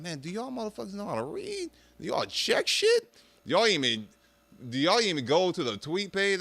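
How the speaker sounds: high-pitched incredulous questioning; exasperated disbelief. Retold or imitated. Telling excited people to check the tweet, exasperated. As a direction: scold them in high, baffled questions. Scolding gullible friends, retold.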